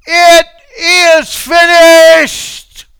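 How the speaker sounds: huge, high, drawn-out cry; Jesus crying out with his last breath; loud cry. Acted. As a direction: cry it out from the cross. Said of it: "with the loud voice with which on the cross he cried".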